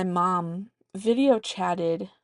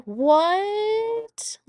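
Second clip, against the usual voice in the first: high, loud astonished what; amazed what; 13 semitones higher, 6 dB louder than usual. Real, not acted. Mother marveling at the surprise, astonished. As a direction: throw it up in disbelief.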